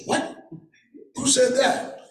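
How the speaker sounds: high, startled outburst with a laugh; shocked boss. Retold or imitated. Boss hearing the slur reported, high startled outburst. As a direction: react as if you can't believe it.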